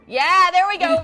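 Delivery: loud proud cheer; triumphant cheer. Real, not acted. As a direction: celebrate the pun. Proud of his pun.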